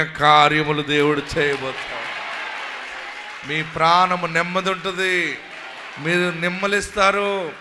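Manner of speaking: loud, rising preaching proclamation; full-force preaching. Acted. Pastor declaring to the congregation, loud and fervent. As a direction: proclaim it over the crowd, building.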